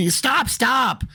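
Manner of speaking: high mock yell; high-pitched retold cry to stop; urgent command. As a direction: imitate a wife calling off her husband. Wife pulling husband away, retold.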